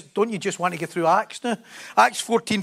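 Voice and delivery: ordinary delivery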